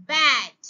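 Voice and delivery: "Bat" said loud as one smooth word; blended word. Shown as a demonstration. Teacher shows the sounds blended into one word. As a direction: blend "bat" together, no pauses. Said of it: "একসঙ্গে sound গুলোকে উচ্চারণ করব".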